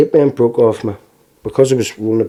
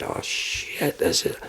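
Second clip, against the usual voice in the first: hushed, voiceless gasp of realisation; retold dread; 4 semitones higher, 13 dB quieter, much slower than usual. Man recalls hearing the iron bar, hushed dread. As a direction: imitate a whispered thought of dread. Retelling his dread as captors return.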